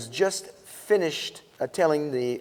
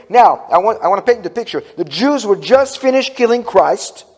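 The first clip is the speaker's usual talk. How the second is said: mild mock preacher, louder; preaching pastor; 5 semitones higher, 7 dB louder than usual. Imitating the senior pastor preaching on Wednesday night, louder. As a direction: retell the pastor's sermon line with pulpit force. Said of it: "our senior pastor was preaching".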